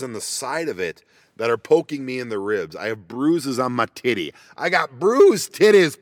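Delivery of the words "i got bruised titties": higher, louder, slow complaint; comic outburst. Real, not acted. Telling viewers about rib bruises, loud comic wail. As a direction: wail it for laughs.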